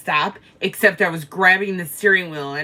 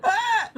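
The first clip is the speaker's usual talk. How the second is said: laughing shriek. Retold or imitated. Laughing so hard can't breathe.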